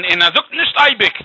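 ordinary delivery

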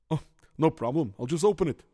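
ordinary talk

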